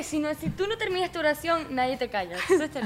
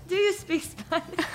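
imitated teacher question; 3 semitones higher, slower than usual. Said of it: "La primera pregunta que le hace es".

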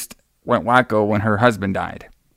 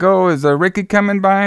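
higher, lightly voiced child asking; a light imitation of the kids; mock kid voice; 7 semitones higher than usual. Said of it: "the kids are asking, like".

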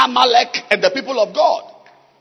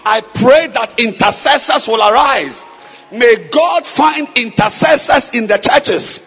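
loud, building prayer declaration; full-force preaching; 2 semitones higher, 5 dB louder than usual. Real, not acted. Preacher praying that intercessors will arise, loud forceful proclamation. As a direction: drive each phrase out to the whole congregation.

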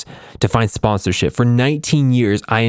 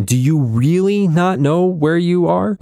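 higher, slow, uneasy question; David, uneasy, pressing Columbus in a lifted voice; 7 semitones higher, slower than usual. Acted. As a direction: ask it confused and a bit worried. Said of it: "somewhat confused and more than a little uneasy".

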